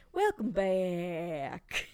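slow, stretched-out welcome; drawn-out host voice. Retold or imitated. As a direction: drag it out like a TV host. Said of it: "Nina keeps doing this thing".

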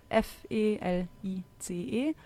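F E L I C E spelled letter by letter; spelling it out. Shown as a demonstration. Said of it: "ich buchstabiere mal Fellas noch". (Buchstabe für Buchstabe buchstabiert)

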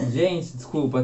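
usual speaking voice

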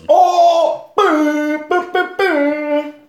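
high, loud celebratory booms; playful drawn-out boom noises; mock explosion booms. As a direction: hoot like a bomb just dropped. Celebrates dropped science with mock explosions.

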